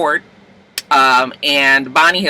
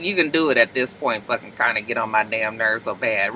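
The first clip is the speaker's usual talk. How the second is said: fed up; 7 semitones lower, 7 dB quieter than usual. Retold or imitated. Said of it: "I was like".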